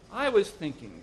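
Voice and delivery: indignant husband; defensive protest. A narrator's reading. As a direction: stand up to the nagging at last. Henpecked husband protests to his wife.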